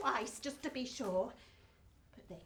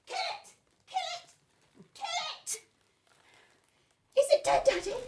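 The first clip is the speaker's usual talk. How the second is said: put-on much higher, squealing small child; little girl's voice; 13 semitones higher, 7 dB louder, faster than usual. Little Betsy urging her daddy to kill it, high child's squeal. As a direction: pipe up high like a scared little girl.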